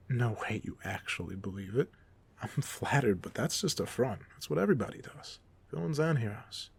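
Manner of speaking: usual speaking voice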